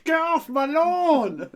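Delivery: put-on shouted, high, slow old-man yell; mock old man yelling off the porch; grumpy old man. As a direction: yell like a cranky old neighbour. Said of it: "those damn kids".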